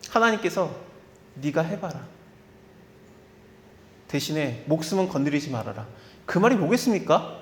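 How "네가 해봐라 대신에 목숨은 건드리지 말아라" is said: 하나님 목소리, 낮고 조용하게 허락하듯; quiet, lower grave command; God's stern permission. Acted. God voiced giving Satan permission. (사탄에게 허락하는 하나님, 낮고 조용한 엄숙한 말투)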